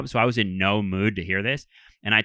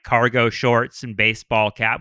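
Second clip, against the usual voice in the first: ordinary talk, nothing marked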